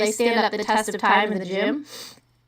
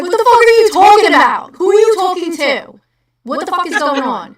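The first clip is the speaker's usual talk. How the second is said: loud, high Jersey outburst; hammed-up angry Jersey shouting; mad Jersey accent; 8 semitones higher, 9 dB louder than usual. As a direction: act out an aggravated New Jersey rant. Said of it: "When I get mad, I'm like".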